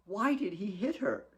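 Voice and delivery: soft, high wounded question; stunned daughter quietly questioning the slap; hurt hush. Acted. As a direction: ask it barely aloud. Kate's stunned inner thought.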